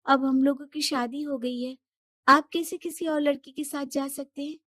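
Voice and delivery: Shilpi hurt. Acted. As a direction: confront husband in disbelief.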